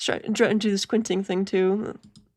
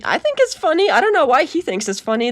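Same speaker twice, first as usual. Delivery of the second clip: higher, louder playful mock; 6 semitones higher, 6 dB louder than usual. Mocking the laughing husband, high and teasing.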